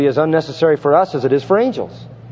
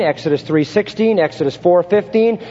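usual speaking voice

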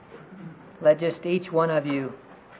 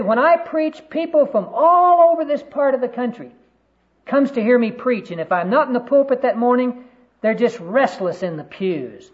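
high, loud boast, easing down at the end; self-important boasting; 8 semitones higher, 5 dB louder than usual. Retold or imitated. Pastor boasting to his critic about his crowds, self-important. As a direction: imitate a preacher puffed up with his own importance.

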